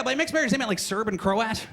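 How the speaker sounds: ordinary talk, nothing marked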